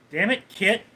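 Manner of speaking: normal talking voice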